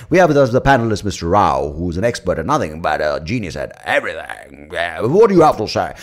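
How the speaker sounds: loud, put-on TV host voice; BBC panel host. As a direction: introduce the guest with mock grandeur. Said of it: "say the B B C, and they're like".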